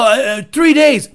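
ordinary talk

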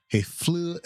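high-pitched, hammed-up Scottish attempt; Scottish accent. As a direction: struggle comically into a Scottish accent. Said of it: "than my Scottish accent".

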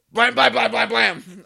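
loud, high rapid-fire blams; mock gunfire. Robber shooting the husband, loud mock gunfire. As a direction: fire off gunshot noises with your voice. Imitates gunshots in retold robbery.

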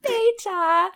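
high-pitched British Peter; British accent. Acted. Co-host echoing the silly name, high British accent. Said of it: "say it in an accent".

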